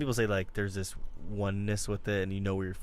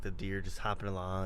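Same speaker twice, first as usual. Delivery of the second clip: normal talking voice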